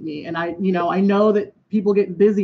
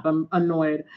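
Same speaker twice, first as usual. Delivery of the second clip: ordinary delivery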